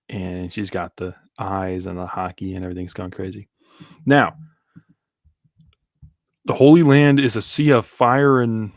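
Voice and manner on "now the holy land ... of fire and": dramatic. Retold or imitated. Rereading line dramatically.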